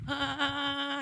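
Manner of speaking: high scared whimper; a kid's panicked little cry; frightened whimper. Retold or imitated. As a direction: son startled outside in the dark. Son panics in the dark.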